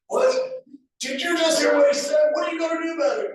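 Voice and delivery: very high, loud indignant shriek; mock outraged mom. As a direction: shriek it like an offended mother. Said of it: "Cindy came unglued, was like".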